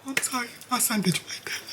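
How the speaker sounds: whispered, tearful apology, imitated; breathy mock-sorry whisper; imitating a crying mom's whisper. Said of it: "she was crying and we talking about".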